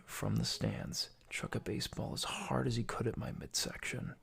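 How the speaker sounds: soft, haunted storytelling; hushed confession. Acted. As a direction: recount it quietly like a buried trauma. Mock-dramatic childhood trauma confession.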